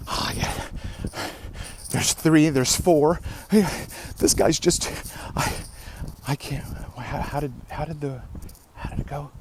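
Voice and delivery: Megamind villain line. Retold or imitated. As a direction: play the beaten villain fading out. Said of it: "That, uh, Megamind line".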